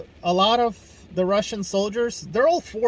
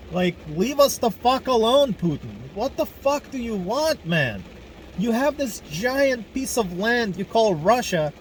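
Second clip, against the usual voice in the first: angry rant at Putin; 5 semitones higher than usual. Real, not acted. A Ukrainian vlogger yelling straight at Putin, in a furious, raised-voice rant. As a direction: tell him off, full of rage.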